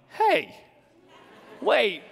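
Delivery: high startled hey, then a laughing wait; mock surprise. Real, not acted. Son catching his mom's jab at his upbringing, high mock-startled. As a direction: catch her words with a playful jolt.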